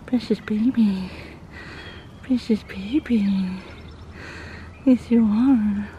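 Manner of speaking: slow, drawn-out tender cooing; doting coo. Real, not acted. Owner doting on Precious the goat, slow tender baby talk. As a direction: coo it slowly, stretching the words.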